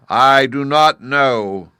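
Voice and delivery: booming, slow, commanding head of Oz; loud, solemn wizard head laying down the terms; great head of Oz. Acted. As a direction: loom over the girl like a giant talking head.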